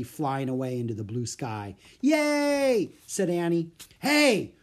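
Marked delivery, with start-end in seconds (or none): 2.0-2.9 high, loud, gleeful cheer; joyful cheer. Acted. Annie cheering as the bird flies away, joyful. 4.0-4.5 high, loud shout of protest. A narrator's reading. Bird seller rushing over to the children, shouting. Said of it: "shouted the bird seller".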